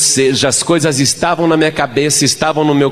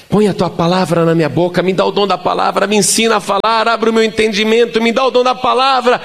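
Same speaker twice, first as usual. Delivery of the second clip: re-enacted plea climbing steadily higher, quick and urgent; pleading prayer; 8 semitones higher, faster than usual. Young preacher begs God for the gift of speech, pleading. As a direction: beg God in a rising, hurried rush. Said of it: "E eu fiquei pedindo".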